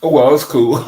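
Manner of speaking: loud, laughing brightly at the mishap; tickled, half-laughing it off; amused excitement. Real, not acted. Streamer laughing off a dead mic light, excited.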